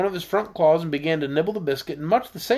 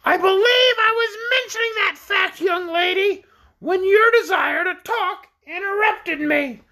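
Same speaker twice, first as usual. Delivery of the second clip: very high, indignant squawk; high-pitched, offended orc; reproachful shrill creature; 3 dB louder than usual. A narrator's reading. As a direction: scold in a piercing bird voice. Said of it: "that was somewhat reproachful".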